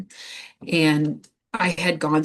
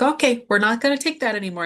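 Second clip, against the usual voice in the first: firm nurse; 6 semitones higher, 4 dB louder, faster than usual. Retold or imitated. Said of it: "she's like".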